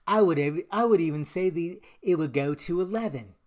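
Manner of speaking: badly faked [name] accent; horrible [name] accent. Host quoting [name] to top his rating, horrible put-on accent. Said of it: "That was a horrible accent".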